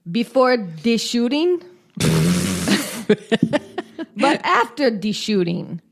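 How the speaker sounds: put-on quoting in a German accent, laughing. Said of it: "mimicked Schultz's German accent".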